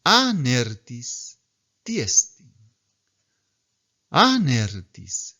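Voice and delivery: Anertis drawn out very slowly, syllable by syllable; teacher models the enclitic slowly; slow Greek reading. Shown as a demonstration. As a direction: read Anertis tiestin slowly for the class. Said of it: "Ahora veamos una enclítica".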